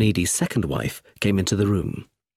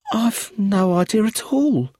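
higher, slow and frightened; 11 semitones higher than usual. A narrator's reading. Staring widow to inspector, slow and scared. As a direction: say it slowly, dazed.

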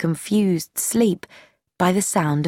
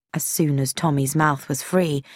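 ordinary delivery

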